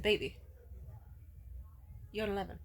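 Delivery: soft, earnest reassurance; tender reassurance. Retold or imitated. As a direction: softly reassure an upset lover. Soothing the upset boyfriend.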